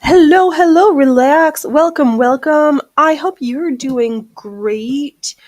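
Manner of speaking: loud, high welcoming call; loud greeting. Real, not acted. Welcoming the new cat in, loud high greeting. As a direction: call out a big cheerful welcome.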